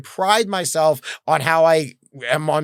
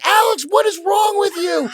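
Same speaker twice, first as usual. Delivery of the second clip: high mock shout of a fed-up wife; imitating exasperated wife; 4 dB louder than usual. Wife finding him peeing on her office wall, high exasperated shout. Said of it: "And she's like".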